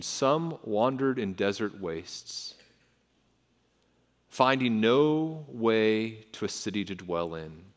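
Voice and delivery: very slow, measured reading with long pauses; slow, solemn reading; meditative scripture reading. Acted. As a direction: let each phrase of the psalm sit.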